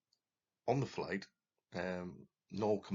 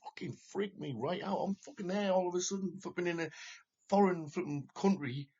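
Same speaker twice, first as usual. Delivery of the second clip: agitated; 11 semitones higher, 4 dB louder than usual. Real, not acted. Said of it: "I freaked out".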